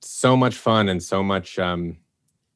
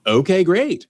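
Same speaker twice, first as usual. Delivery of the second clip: high, bright eager agreement; eager okay; 9 semitones higher, 5 dB louder than usual. Retold or imitated. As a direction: jump at the offer happily. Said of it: "And I was like".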